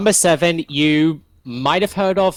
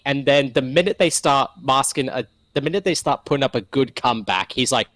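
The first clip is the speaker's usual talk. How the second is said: usual speaking voice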